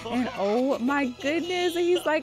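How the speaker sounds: loud, high burst of proud joy; overjoyed dad. Acted. Dad celebrating his daughter learning to ride, loud and proud. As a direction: shout your pride at her.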